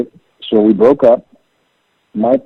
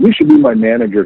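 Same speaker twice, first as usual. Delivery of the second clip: mock suggestion, pitched up high; joking; 11 semitones higher than usual. Bandmate teasing his friend after money talk, joking. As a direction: imitate a friend's joke in a high voice. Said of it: "he joked".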